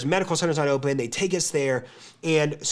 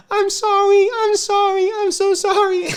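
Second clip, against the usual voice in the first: put-on high, louder, fluttery old-lady apology; sweet old lady apologizing; 5 dB louder than usual. Old Fijian nurse apologizes to the screaming patient, high fretful apology. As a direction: imitate a sweet old nurse begging forgiveness. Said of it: "this sweet old Fijian lady".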